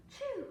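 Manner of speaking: gasp, then high "choo"; mimed sneeze. A narrator's reading. Storyteller as the dog catching cold, mimed high sneeze. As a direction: act out the dog's sneeze. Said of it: "and the sneeze".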